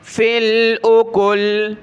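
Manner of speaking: slow, drawn-out chanted recitation; Quranic recitation. Shown as a demonstration. Teacher models the verse for the student, slow chant. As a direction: recite each word long and even.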